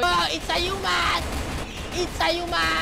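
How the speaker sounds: high-pitched pretend alarm cry; mock alarm. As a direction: sound the alarm, high and drawn out. Playing dinos alarmed by a human. (kunwaring gulat na sigaw)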